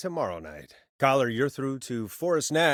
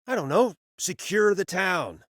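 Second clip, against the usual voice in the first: higher, begging tone; pleading; 8 semitones higher than usual. Acted. Host begging Leslie to secure the town, higher pleading.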